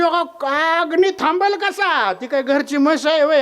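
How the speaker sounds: high-pitched disbelieving questions; acted exasperated disbelief; incredulous scoffing. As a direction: scoff at an absurd claim. Neighbour scoffs at stopping fire.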